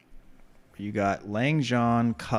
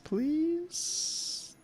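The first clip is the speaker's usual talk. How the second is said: high pleading squeak; 6 dB quieter than usual. Pretend. Pleading for an item transform.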